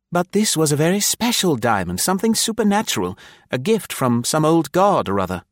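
high, keen youngster's voice explaining himself; eager young officer. Acted. Embarrassed young officer explaining the diamond rumour, high and earnest.

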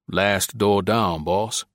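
put-on slow, drawling Virginia accent; lazy Southern Virginia drawl. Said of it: "His accent was of an uneducated Virginia Negro".

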